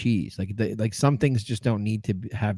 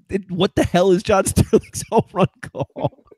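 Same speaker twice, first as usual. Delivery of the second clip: incredulous; 9 semitones higher, 4 dB louder than usual. Retold or imitated. Reading headline incredulously.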